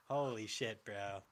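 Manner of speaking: ordinary talk, nothing marked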